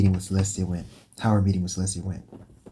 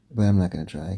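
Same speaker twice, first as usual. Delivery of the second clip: normal talking voice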